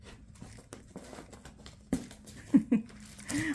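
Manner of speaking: breathy high-pitched rising laugh; high giggle. Real, not acted. Laughing at the dog.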